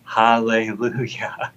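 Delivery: put-on slow, careful little-boy echo of hallelujah; a light imitation of a small child sounding out hallelujah; drawn-out childlike hallelujah; child repeating his first word. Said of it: "Landon starts repeating after us".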